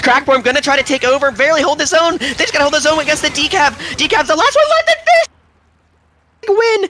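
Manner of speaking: high, urgent climax building to a scream; excited play-by-play. Real, not acted. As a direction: call the deciding moment at full excitement. Climax of live match call.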